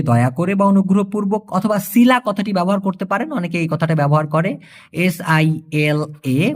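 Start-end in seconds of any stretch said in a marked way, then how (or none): 4.9-6.5 slow, letter-by-letter spelling of the word; spelled letter by letter. Shown as a demonstration. Teacher to students, spelling out the Malay word slowly. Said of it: "উচ্চারণটা হবে সিলা".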